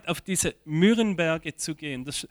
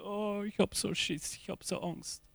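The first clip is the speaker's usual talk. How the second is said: higher, much softer frightened voice; mock fearful Gideon; 5 semitones higher, 11 dB quieter than usual. Preacher voices Gideon's frightened thought, mock fearful and hushed. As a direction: play a timid hero quietly owning up to fear. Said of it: "Der Gideon dachte".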